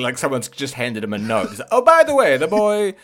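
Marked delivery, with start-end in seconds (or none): 1.7-2.9 put-on high, rushed Swedish accent; high Swedish accent, hurried; hasty Swedish king. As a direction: Swedish accent like reading a note handed over. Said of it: "sort of hastily".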